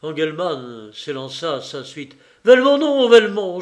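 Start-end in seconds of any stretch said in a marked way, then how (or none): 2.4-3.1 high, loud pleading cry after a man; desperate cry. A narrator's reading. Banker crying out to Lupin not to go, loud and desperate. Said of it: "La voix d'Engelmann s'étrangla". 3.1-3.6 a second loud, high pleading call; desperate cry. A narrator's reading. Banker calling Lupin back a second time, loud and pleading. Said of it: "La voix d'Engelmann s'étrangla".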